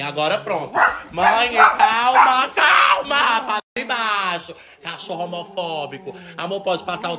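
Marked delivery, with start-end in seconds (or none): 1.1-4.5 high, drawn-out yell; slow, high-pitched pleading shout; exasperated hollering. Real, not acted. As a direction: stretch the words over a barking dog. Yells over the barking dog.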